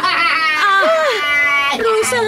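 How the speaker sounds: mother shouting the news to her daughter; excited shout. Acted. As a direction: shout it out, pitch jumping high. Mother exclaiming about husband.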